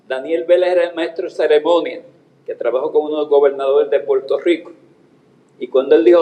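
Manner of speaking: ordinary delivery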